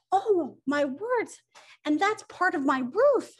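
high, slow gasp of horror; hammed-up shock, rising high and loud; appalled discovery. Woodpecker finding bits of his wrecked house, appalled high cry.

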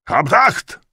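very high, very loud military call; loud shout. A narrator's reading. Soldier announcing the officer's arrival, shouting attention. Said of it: "głośny okrzyk".

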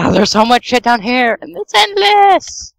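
high-pitched, stretched-out complaint; overwhelmed whine rising to a squeal; exasperated wail. Real, not acted. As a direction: climb to a long high wail on the last word. Overwhelmed by mobs underground.

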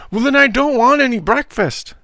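put-on loud, high-pitched childish wail; tearful tantrum. A narrator's reading. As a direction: refuse at the top of a boy's voice, near sobs. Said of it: "relapsing into sobs".

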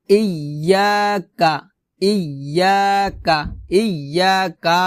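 ইয়াকা with ইয়া held and কা brief; long YA, short KA. Shown as a demonstration. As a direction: stretch ইয়া, don't drag কা in ইয়াকা. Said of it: "কাতে টানা যাবে না".

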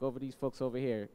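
ordinary delivery

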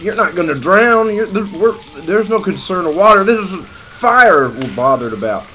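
high, very loud rescuer shouting; frantic yelling. Retold or imitated. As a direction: yell over the kid's panic. Acts out yelling at kid in fire.

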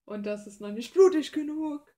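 sudden loud, high burst of demanding glee. Retold or imitated. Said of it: "weil ich dann immer komme".

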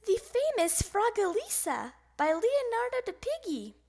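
put-on high, excited frog-kid voice; high-pitched eager exclamation; excited yelling. A narrator's reading. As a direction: announce it high with delight. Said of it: "yelled Froggy".

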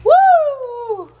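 high, loud laughing whoop; laughing woo. Real, not acted. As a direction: let out a happy whoop. Cheering, laughing.

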